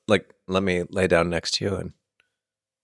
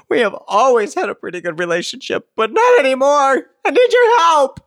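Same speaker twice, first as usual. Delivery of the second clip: much higher, louder, pleading letter voice; distraught letter writer; 7 dB louder than usual. Acted. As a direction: read it as a panicked wife. Reading desperate letter writer.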